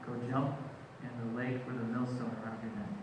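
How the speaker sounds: low, soft, stern command; grave quiet order. Acted. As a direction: let the threat sink low and quiet. Acting Jesus's stern order, dropping away.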